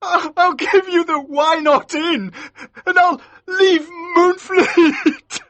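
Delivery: high, slow sobbing plea; crying like a baby. A narrator's reading. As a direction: blubber the last offer through tears. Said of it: "Maskew started crying like a baby".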